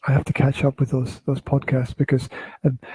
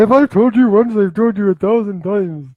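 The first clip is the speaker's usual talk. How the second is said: mock scolding; 6 semitones higher, 4 dB louder than usual. Mock scolding partner over cables.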